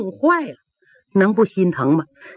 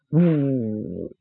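low, soft whoosh; whooshing palm; 7 semitones lower, 5 dB quieter than usual. A narrator's reading. Narrator mimics a palm flying at the face, low whoosh. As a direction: imitate the wind of a strike.

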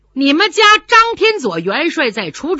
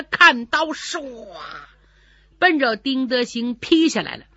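ordinary delivery, nothing marked

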